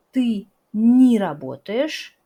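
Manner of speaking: я не работаю said slowly and loudly, не as ni joined to the verb; slow clear model. Shown as a demonstration. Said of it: "se prononce comme ni". (я не работаю lent et fort, не prononcé ni, collé au verbe)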